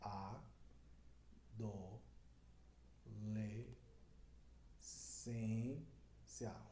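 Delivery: adolescência said syllable by syllable, each one apart; split into syllables. Shown as a demonstration. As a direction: break adolescência into its five syllables slowly. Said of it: "Ela tem cinco sílabas". (separando as sílabas de adolescência)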